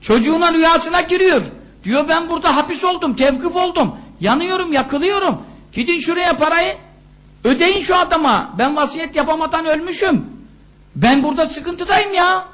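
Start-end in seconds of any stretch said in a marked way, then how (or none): 2.1-12.5 high-pitched urgent plea; acted cry for help, pitched high; pleading dead father. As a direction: plead from the grave, voice raised in pitch. Dead father pleads in son's dream.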